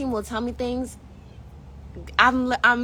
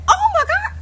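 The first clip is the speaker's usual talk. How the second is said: high, loud shocked cry; shocked gasp; 8 dB louder than usual. Retold or imitated. Retelling her panic on the exam table, high shocked cry. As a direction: cry out in alarm.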